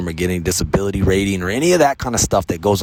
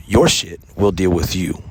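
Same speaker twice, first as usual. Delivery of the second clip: ordinary talk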